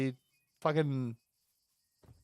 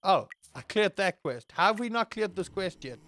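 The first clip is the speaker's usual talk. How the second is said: ordinary talk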